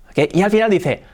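ordinary talk, nothing marked

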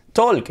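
"talk" with an O and a sounded L, "tolk"; L wrongly pronounced, O vowel. Shown as a demonstration. Teacher imitating learners' doubt, "talk" as "tolk" with audible L. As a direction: round the vowel of "talk" but keep the L. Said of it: "es una O".

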